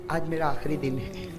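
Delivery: soft farewell. Acted. Fatima's farewell voiced softly.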